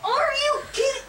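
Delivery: high outraged yelling; raging gamer. Real, not acted. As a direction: shriek in disbelief. Gamer killed again.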